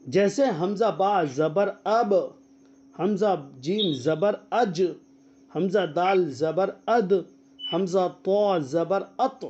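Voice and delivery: slow, bouncing the final letter in اب، اج، اد، ات; qalqala demonstration. Shown as a demonstration. Quran teacher to students, slow qalqala drill. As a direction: spell out اب، اج، اد، ات slowly so each last letter springs back. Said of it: "اس کو قلقلہ کہتے ہیں".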